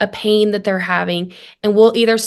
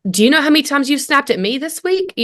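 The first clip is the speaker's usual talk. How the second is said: fast, higher defensive retort; 5 semitones higher, faster than usual. Acted. Wife answering her hurt husband, defensive. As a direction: fire back a counter-accusation. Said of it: "I would just immediately defend".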